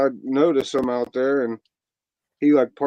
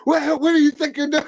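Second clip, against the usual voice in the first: much higher mock outraged old guys; old guys on the porch confronting the fire chief, much higher; indignant old men; 14 semitones higher than usual.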